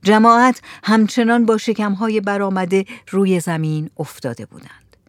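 usual speaking voice

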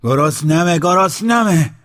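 loud, high shout of hunger; a demanding hungry yell. Acted. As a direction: shout both words, rising. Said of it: "داد زد".

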